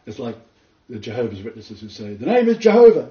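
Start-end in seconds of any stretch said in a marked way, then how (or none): 2.1-3.1 loud, high mock of a confident claim; mocking quote. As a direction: belt it out like a smug believer. Mocking Jehovah's Witnesses claim.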